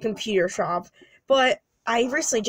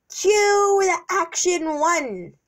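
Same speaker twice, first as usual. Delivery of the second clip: higher, slow director's call; drawn-out cue; 6 semitones higher, slower than usual. Pretend. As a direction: call the scene in, stretched out. Calling out a scene cue.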